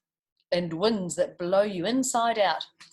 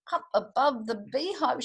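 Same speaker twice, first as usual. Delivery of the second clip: plain reading voice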